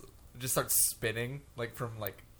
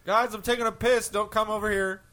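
calling out; 8 semitones higher, 5 dB louder than usual. Pretend. Acting an astronaut calling out.

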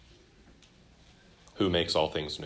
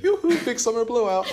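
higher, lilting mock shopkeeper call; shopkeeper sing-song; 4 dB quieter than usual. Teacher quoting the Frozen shopkeeper to his class, sing-song. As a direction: sing it out like a cheery salesman. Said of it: "I love the dude who's like".

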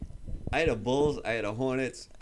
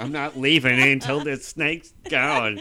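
loud, slow, higher mock refusal; mother-in-law impression; 4 semitones higher, 9 dB louder, slower than usual. Co-host re-enacting the mother-in-law's snake standoff, mock protest. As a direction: play a stubborn mother-in-law, hammed up. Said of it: "Talking about".

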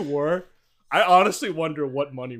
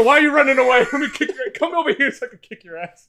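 mock angry yelling; 8 semitones higher, 6 dB louder, faster than usual. Mock chasing friend to fight.

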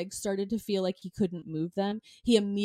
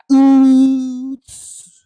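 mock oops; 4 semitones higher, 16 dB louder than usual. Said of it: "be like".

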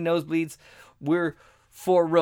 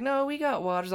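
lightly voiced wife, higher; a hint of the wife turning it down; wife's reply; 8 semitones higher than usual. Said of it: "She's like".

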